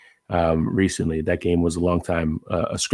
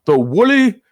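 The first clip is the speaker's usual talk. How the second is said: high, loud hammed-up sportscaster call; mock highlight-reel announcer; Chris Berman; 9 semitones higher, 7 dB louder, slower than usual. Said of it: "to do Berman".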